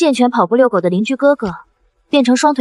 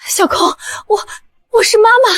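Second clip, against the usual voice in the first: choked, halting words rising higher; tearful greeting; 10 semitones higher, faster than usual. Acted. Mother introducing herself to her long-lost daughter, tearfully halting. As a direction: break into a high, emotional plea.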